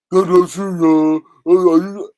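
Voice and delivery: loud gasp into a mock protest; mock gasping denial. Vlogger mocking a streamer's dramatic gasping denial. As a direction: play the victim with a loud gasp. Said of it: "He run to that every time".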